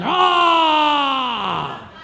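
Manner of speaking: put-on loud high war cry, toddler charging into battle; Braveheart battle yell. Toddler yelling in the shop, a Braveheart yell. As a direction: let out a Braveheart roar. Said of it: "he was just yelling".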